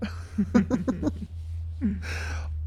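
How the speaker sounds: a light airy laugh; soft breathy laugh. Real, not acted. Amused at her own calm era line, soft laugh. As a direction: laugh it off softly.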